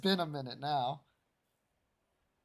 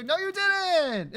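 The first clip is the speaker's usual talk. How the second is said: high, slow "No, you didn't!" in disbelief; outraged denial, pitched way up; indignant protest; 12 semitones higher, 3 dB louder, slower than usual. Real, not acted. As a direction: protest it, much higher and drawn out. Arguing over missed chat message.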